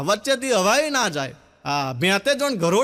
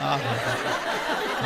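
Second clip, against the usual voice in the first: laugh; 10 semitones lower, 5 dB quieter, much slower than usual. Real, not acted. Laughs at own joke.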